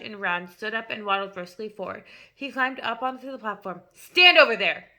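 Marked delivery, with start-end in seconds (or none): 3.9-4.9 loud, sharp command; barked order. A narrator's reading. Headmistress ordering the boy aside on stage. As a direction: bark it like a sergeant. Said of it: "the Trunchbull ordered".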